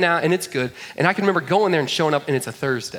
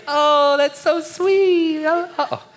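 much higher, louder and very slow; cooing; 6 dB louder, much slower than usual. Retold or imitated. Girl gushes over the little brother, high and slow. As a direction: gush over the cute baby brother. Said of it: "she's like".

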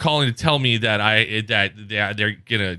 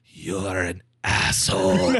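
low, very slow put-on insult; lower, drawling mock-accented caller; fed-up scam caller; 5 semitones lower, much slower than usual. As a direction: imitate an offended caller, drawing out each word.

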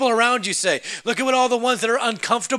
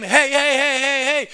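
high, rapid-fire mock calling; mock yell, pitched up; imitated yelling; 6 semitones higher than usual. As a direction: pester for attention with a raised, repetitive call. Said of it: "If someone's yelling your name".

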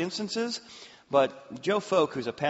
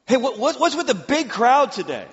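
eager question; 9 semitones higher, 7 dB louder than usual. Acted. Blind man voiced asking crowd.

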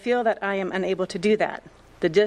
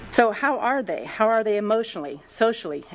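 usual speaking voice

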